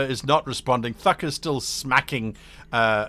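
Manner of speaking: ordinary talk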